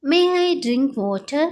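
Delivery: very slow, clear classroom English; slow model phrase. Shown as a demonstration. Teacher modelling the phrase for Telugu students, slow and clear. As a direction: spell it out slowly for learners. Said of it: "అప్పుడు ఇలా అంటారు".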